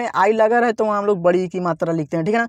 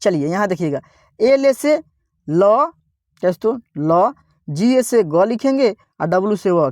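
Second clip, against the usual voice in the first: ordinary talk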